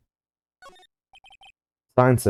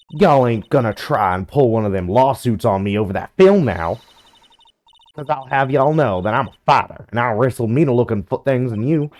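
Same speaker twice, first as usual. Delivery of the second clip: put-on Southern accent; 3 dB louder than usual. Southern woman.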